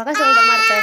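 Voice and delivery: put-on loud, high scolding of the splashing girls; scolding shout. Mother scolding daughters jumping in puddles, shouting high and loud. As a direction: yell at them to stop jumping in the water. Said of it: "पण म्हणलं होतं".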